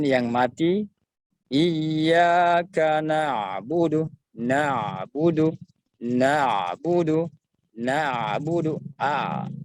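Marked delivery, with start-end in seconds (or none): 1.5-8.7 "na'budu" with the ain half held, slow; ain sukun demo. Shown as a demonstration. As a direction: recite slowly, ain between stop and flow. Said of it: "Saya bacakan".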